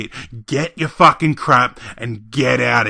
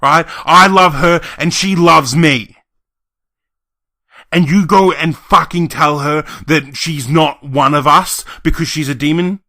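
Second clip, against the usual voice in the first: raised, emphatic indignant rant; angry outburst; 12 semitones lower, 7 dB louder than usual. Acted. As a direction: let pent-up grievances burst out. Acted furious rant at relatives.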